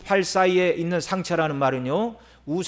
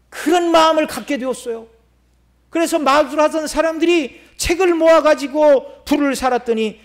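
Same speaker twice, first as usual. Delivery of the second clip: higher-pitched, forceful sermon delivery; impassioned preaching; 7 semitones higher, 5 dB louder than usual. Real, not acted. Preacher tells of sorcerers burning their books, impassioned preaching.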